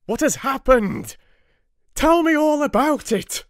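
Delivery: high, loud urgent demand; crying out. A narrator's reading. Husband demanding the doctors explain, crying out loud. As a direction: shout the demand, frantic. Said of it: "he cried".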